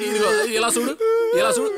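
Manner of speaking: tearful. Acted. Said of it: "నాకు ఏడుపు వచ్చేది".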